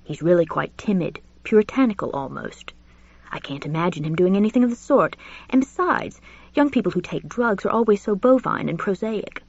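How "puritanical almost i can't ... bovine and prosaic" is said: put-on lightly raised, fussy elderly gentleman; a genteel old professor gossiping, pitched up; Julian's voice. Narrator voices Julian confiding to Richard, genteel and high.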